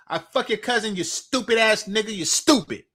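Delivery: ordinary talk, nothing marked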